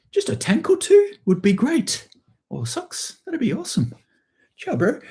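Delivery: high-pitched, hammed-up New Zealand accent; put-on New Zealander, way up high; mock New Zealand accent. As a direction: squeak out a comic Kiwi plea to a neighbour. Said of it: "apologize to all my New Zealand friends for that, that accent".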